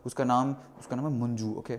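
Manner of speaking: normal talking voice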